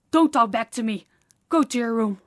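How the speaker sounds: loud, forceful order; angry command. Acted. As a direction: shut down any backtalk. Ordering the servant away.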